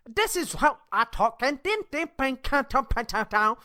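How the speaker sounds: a jokey, high imitation of Chinese tones; mock Chinese tones. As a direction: bounce the pitch like mock tones. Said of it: "it's all tones".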